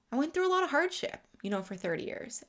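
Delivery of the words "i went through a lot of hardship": fighting back tears while recalling hardship; getting emotional. Real, not acted. To viewers, recalling thirty hard years, emotional and rushed. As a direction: voice rising and rushing with emotion, higher and louder. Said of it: "I'm getting a little emotional".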